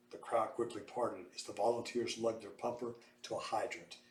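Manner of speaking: ordinary delivery, nothing marked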